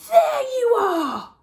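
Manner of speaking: loud, high and slow call of relief. Acted. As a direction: stretch it out as if you finally found it. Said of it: "I said out loud".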